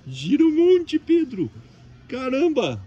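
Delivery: high excited squeal; thrilled, shouting the fan's name. Real, not acted. Excited about spinning fan.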